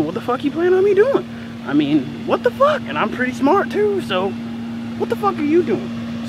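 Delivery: high, incredulous mock rant; indignant outburst. Pupil silently fumes at teacher, indignant. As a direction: replay the outrage at full pitch.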